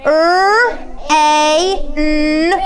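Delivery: R, A, N each sound said separately, loud and clear; teacher models sounding out; sounding out letters. Shown as a demonstration. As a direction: segment the word R, A, N slowly. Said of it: "So it will be like this".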